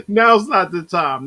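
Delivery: laughing. Real, not acted. Laughing quip.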